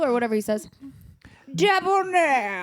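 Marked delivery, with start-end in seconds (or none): 1.5-2.6 slow creaky voice of an ancient judge; drawn-out, frail old judge; old dying judge. Acted. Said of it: "an old, almost dead judge".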